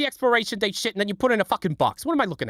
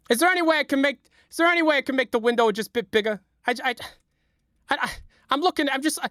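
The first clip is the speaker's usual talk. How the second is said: normal talking voice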